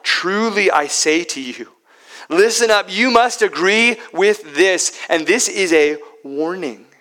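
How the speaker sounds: loud, high, urgent insistence; emphatic warning. Acted. Preacher urging the congregation to heed Jesus, loud emphatic warning. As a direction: drive the warning home hard. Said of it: "It's emphatic".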